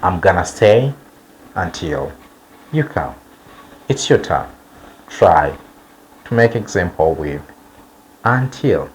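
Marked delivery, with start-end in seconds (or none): none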